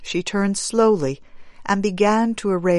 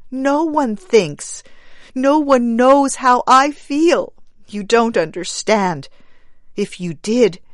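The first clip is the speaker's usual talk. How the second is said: high, choked and tearful; 5 semitones higher, 4 dB louder than usual. Acted. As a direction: force it out through tears. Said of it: "choked the woman".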